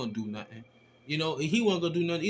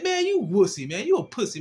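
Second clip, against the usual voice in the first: higher and louder teasing insult; mocking taunt; 5 semitones higher, 5 dB louder than usual. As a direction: rub it in his face. Taunting rider again.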